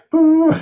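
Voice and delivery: high, ringing hoot of a flute; bursting flute tone imitation; imitated flute note. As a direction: sing out a sudden high, loud "boo" like a flute. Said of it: "this beautiful sound".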